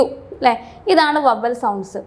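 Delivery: ordinary talk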